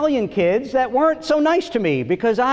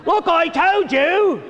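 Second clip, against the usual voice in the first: slow, higher mock-sexy English accent; 8 semitones higher, slower than usual. Comic mocking a sexy English accent for the women, slow. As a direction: purr it in hammed-up English accent. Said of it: "this sexy English accent".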